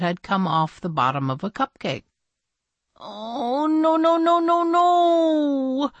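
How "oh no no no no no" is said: high, drawn-out moan of no; rising, stretched-out moaning nos. Acted. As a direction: wail the nos in dismay. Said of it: "she moaned".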